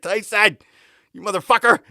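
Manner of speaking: loud, high holler at a buddy; mock-outraged shout. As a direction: yell at the friend who pranked you. Retold calling out his prankster buddy.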